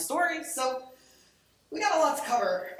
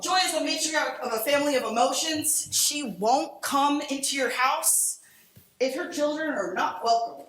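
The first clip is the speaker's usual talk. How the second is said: quote reading; 3 semitones higher, 4 dB louder than usual. Shown as a demonstration. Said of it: "here's a quote".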